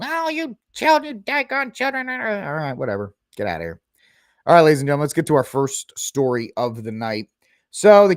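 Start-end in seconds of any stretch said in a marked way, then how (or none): 0.0-2.1 put-on high cranky old man voice; grumpy old boomer. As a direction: scold like an old man yelling at kids. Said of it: "all these boomers yelling".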